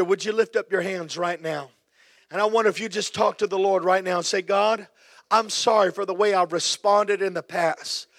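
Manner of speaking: ordinary delivery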